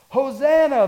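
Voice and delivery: loud, high crowd cry; acted shout of praise; yelling out. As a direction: call it out like a cheering crowd. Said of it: "they're yelling out".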